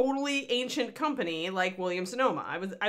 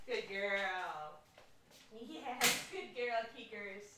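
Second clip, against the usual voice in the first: soft praise for the dog; 13 dB quieter, much slower than usual. Real, not acted.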